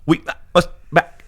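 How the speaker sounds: muffled drive-thru intercom voice; drive thru speaker. Pretend. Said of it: "Do it in your drive-through voice".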